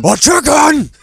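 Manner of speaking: high, loud outburst of alarm; mock horrified shout. Villain learning she has a chicken, horrified shout. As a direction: shriek it in exaggerated horror. Master reacts in horror to chicken.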